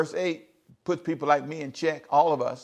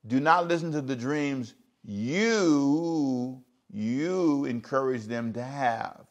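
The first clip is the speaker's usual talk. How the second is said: even slower, every word spaced out; slow emphatic repeat; much slower than usual. Shown as a demonstration. Preacher repeating the verse to the congregation, very slowly. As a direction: draw the verse out for them to notice.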